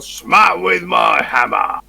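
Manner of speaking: loud heroic declaration; declaring attack. Acted. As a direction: boom it out like a hero.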